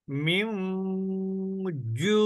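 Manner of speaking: মিম ending in a long nasal hum, then a stretched জু; ghunnah demo. Shown as a demonstration. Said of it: "অনিশ্বারের মতন উচ্চারণ করছি".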